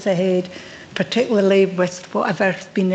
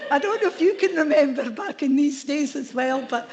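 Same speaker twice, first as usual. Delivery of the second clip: higher-pitched, laughing tease; amused teasing; 6 semitones higher than usual. Real, not acted. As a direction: tease a colleague through real laughter. Teasing Lois, audience laughing.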